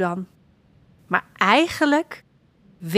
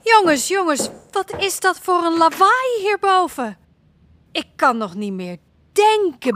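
mama calling up; 6 semitones higher than usual. Acted. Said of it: "Roept mama".

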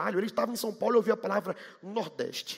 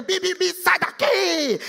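mock traffic yell; 7 semitones higher, 4 dB louder, slower than usual. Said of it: "E a gente gritando".

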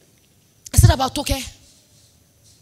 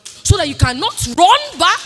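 ordinary talk

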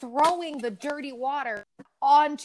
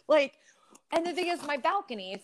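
ordinary talk, nothing marked

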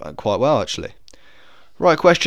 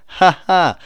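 a loud, higher "ha ha" at his own pun; mock laugh; 6 semitones higher, 7 dB louder than usual. Real, not acted. Quiz host laughing at his own pun, hammy laugh. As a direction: laugh at your own bad joke.

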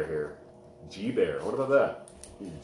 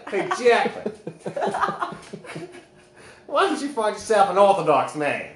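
mock Southern twang, higher and louder; Southern accent; 8 semitones higher, 3 dB louder than usual. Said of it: "It just sounds like Southern".

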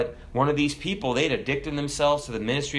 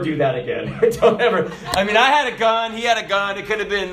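laughing through the words, louder and higher; 7 semitones higher, 5 dB louder than usual. Real, not acted. Preacher tells the congregation about the soldier's kiss, laughing loudly. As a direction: crack up while telling it.

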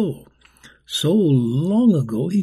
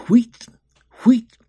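1 whistle call imitation; 9 semitones higher, 9 dB louder than usual. A narrator's reading. Whistle signal for the crew.

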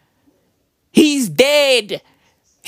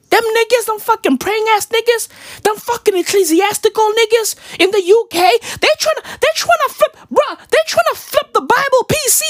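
worked-up rant; 9 semitones higher than usual. Acted. Exasperated rant, voice pitched up.

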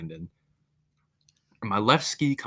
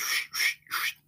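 shushing sound effect. Retold or imitated. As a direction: breathe out a swishing shh. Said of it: "I should probably put sound effects there".

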